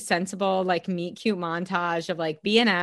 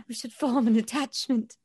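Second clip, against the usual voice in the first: higher-pitched, badly faked Bridgerton accent; terrible Bridgerton accent; 4 semitones higher than usual. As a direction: quote it in a hammed-up Bridgerton accent. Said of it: "That was just a terrible accent".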